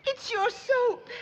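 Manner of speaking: high, slow, sobbing wail; hammed-up crying to Granny. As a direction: blubber it out through tears. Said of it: "Dry them tears ... bawling like that".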